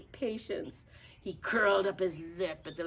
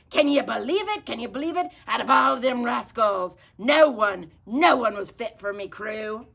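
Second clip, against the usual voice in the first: loud, higher indignant rant; loud pirate rant; 5 semitones higher, 11 dB louder than usual. Acted. Pete complaining to his parrot about the rascals, loud and indignant. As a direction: vent to the parrot at full volume.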